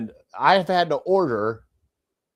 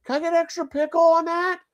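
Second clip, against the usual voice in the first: much higher imitation of a kid ordering; mock kid voice. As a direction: squeak it out like a little kid. Imitating family member's order.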